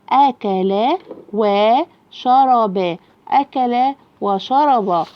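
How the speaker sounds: slow, syllable-by-syllable reading; scandito per sillabe. Shown as a demonstration. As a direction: stretch out each syllable for a young reader. Teacher models syllable-by-syllable reading.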